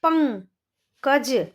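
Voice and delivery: Pankaj drawn out very slowly; slow sounding out. Shown as a demonstration. Teacher sounding out Pankaj slowly for children. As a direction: stretch Pankaj slowly, syllable by syllable. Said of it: "तो कैसे करेंगे?".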